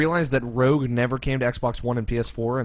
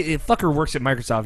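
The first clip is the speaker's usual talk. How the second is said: normal talking voice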